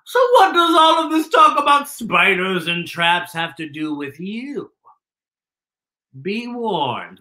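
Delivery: put-on a gloating spider reads its note to the children; sly spider letter.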